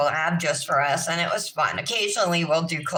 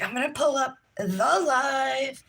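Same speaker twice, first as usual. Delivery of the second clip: lilting, higher sing-song; 6 semitones higher than usual. Shown as a demonstration. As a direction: sing it out like a greeting. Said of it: "I'll be like".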